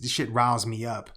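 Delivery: normal talking voice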